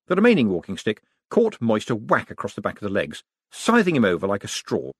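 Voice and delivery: ordinary delivery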